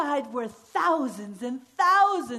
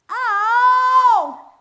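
high, loud ow; yelp; 11 semitones higher, 13 dB louder than usual. Acted. Min's High yelping as she pops out. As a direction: cry out sharply.

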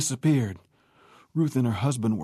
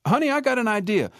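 higher excited call; lightly voiced husband calling out; calling to wife; 11 semitones higher than usual. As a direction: shout down a bright idea.

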